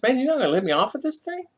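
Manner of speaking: high, quick pleading protest. Retold or imitated. Driver to deputy, a quick high pleading protest. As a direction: beg your way out of the ticket. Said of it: "I said".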